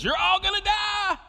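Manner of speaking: high, loud mock prophet yell; doomsday shout. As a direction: yell it like the crazy corner guy. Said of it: "Jonah comes in and says".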